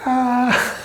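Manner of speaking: high-pitched, held "ah" of fright; imitated shriek at a nasty shock; mock scream. Imitates reaction to snake on neck.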